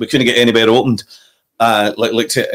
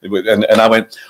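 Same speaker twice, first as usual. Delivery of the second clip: ordinary delivery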